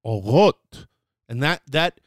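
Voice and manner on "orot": "Orot" with a throaty Hebrew R; careful Hebrew pronunciation. Shown as a demonstration. Teacher trying to say it right, "Orot" with Hebrew resh. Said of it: "let me say it right".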